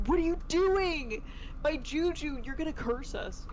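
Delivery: high, loud, panicked outburst; alarmed mock yell. Retelling how she scolded Barty for moving the owl, in a high panicked yell. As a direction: play it like someone just knocked over your good-luck charm. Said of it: "and I was like".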